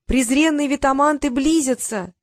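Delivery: high, declaiming stage-hero youth; thin-voiced exclamation. A narrator's reading. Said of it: "тонким голосом воскликнул юноша".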